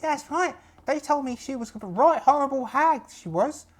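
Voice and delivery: very high-pitched, hammed-up pirate voice; gossiping pirate. Pirate backing up gossip about the hag, high eager voice.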